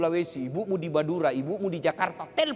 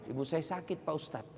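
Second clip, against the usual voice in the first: timid student; 2 semitones lower, 10 dB quieter than usual. Retold or imitated.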